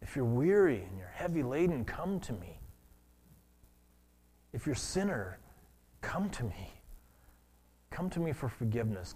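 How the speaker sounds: soft, hushed, almost whispered come to me; tender invitation. Acted. Jesus inviting the weary and sinners, soft and tender. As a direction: draw the weary in gently.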